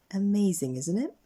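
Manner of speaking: usual speaking voice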